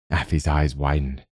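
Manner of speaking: usual speaking voice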